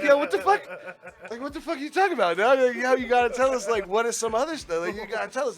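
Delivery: high, loud mock disbelief; incredulous outcry. Cooks confront the guy calling tickets, incredulous and high.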